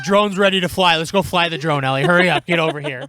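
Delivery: retold shout across the beach, urging Ellie to hurry; calling out. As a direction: yell it across the beach to a kid.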